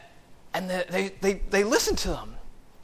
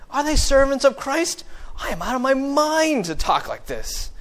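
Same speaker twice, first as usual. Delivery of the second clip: higher, louder mock boasting as it builds; sarcastic; 5 semitones higher, 4 dB louder than usual. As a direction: brag defiantly, with a sarcastic edge. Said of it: "in a very sarcastic voice".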